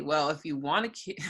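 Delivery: ordinary talk, nothing marked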